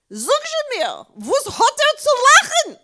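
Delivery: loud, high-pitched Yiddish outburst; father crying out the punchline; exasperated demand. Acted. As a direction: build to an incredulous shout.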